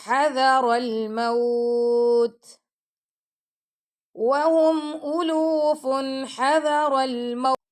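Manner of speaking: slow, melodic tajweed recitation; Quran recitation. Shown as a demonstration. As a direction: chant the verse slowly and evenly, teaching by example. Teacher recites Quran between explanations.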